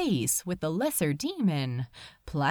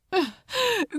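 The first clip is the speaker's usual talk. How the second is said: pained sigh; 11 semitones higher than usual. Acted. Angel sighs in distress.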